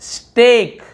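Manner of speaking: long stretched "ay" in स्टेक; स्टेक with a lengthened, falling vowel; drawn-out vowel. Shown as a demonstration. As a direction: draw out स्टेक loud and clear. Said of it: "यहाँ पर भी लंबा खींचे".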